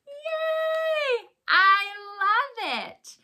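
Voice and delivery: high, slow, delighted cheer; excited cheer. Real, not acted. Teacher cheering a kid on.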